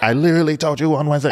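high, fast exasperated voice; mock her exasperation, fast; mocking partner. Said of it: "And you're like".

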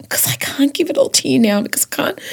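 high frazzled whisper. Real, not acted. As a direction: whisper it in a flap.

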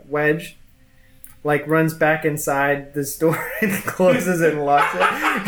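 ordinary talk, nothing marked

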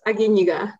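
Aguiniga said ah-gee-NEE-gah; correcting pronunciation. Shown as a demonstration. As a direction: give the surname Aguiniga clearly, with ee sounds. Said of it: "Did I mispronounce your, your last name?".

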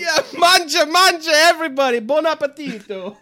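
loud, high hammy Italian host; booming sing song welcome. Pretend. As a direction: boom out a welcome to the buffet. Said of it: "I'm not doing accents".

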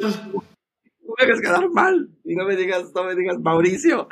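high, drawn-out pleading on the phone; nervous begging. Retold or imitated. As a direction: beg your brother not to embarrass you. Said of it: "así todo nervioso".

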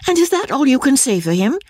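put-on a touch of girlish indignation; indignant. A narrator's reading. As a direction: demand it, offended on his behalf. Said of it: "cried Marianne indignantly".

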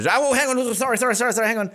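high, flustered burst of apologies; urgent interruption. Real, not acted. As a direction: cut in urgently, words tumbling. Interrupts himself for sudden breaking news.

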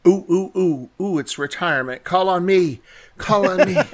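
ooh ooh, pick me eagerly; bouncing to be called on; eager student. Real, not acted. Eager student wanting to answer.

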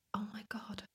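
shocked whisper. Retold or imitated. Shocked by her reflection in the club bathroom, whispered. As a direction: whisper it as if catching yourself in the mirror.

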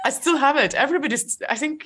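high, loud words through laughter; laughing admission. Real, not acted. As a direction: confess it while cracking up. Laughing admission of imposter syndrome.

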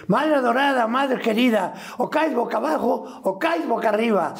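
high clown voice reciting; silly clown voice. Retold or imitated. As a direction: ham it up like a circus clown. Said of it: "Hablaba y decía".

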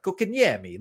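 "Kokanyemi" with a light Italian flourish, stretched vowels; little accent. Shown as a demonstration. Showing how he likes to say the name, light Italian accent. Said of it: "a little accent on it".

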